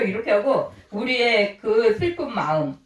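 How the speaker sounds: ordinary talk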